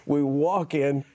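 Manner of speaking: ordinary talk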